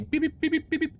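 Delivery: high, repeated electronic beeps; imitated hail beeps. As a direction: chirp it out like a comms signal. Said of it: "It's basically, like, being".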